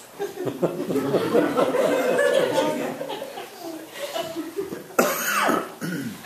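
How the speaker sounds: bursts out laughing at her own line; helpless giggling fit; real laugh. Real, not acted. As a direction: breaks into a long giggle. Reader laughs at own story.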